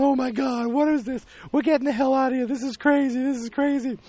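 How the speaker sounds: high-pitched mock panic; freaking out. People watching the face smashing, mock freaking out. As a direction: babble in imitated alarm, voice pushed way up. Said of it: "freaking out".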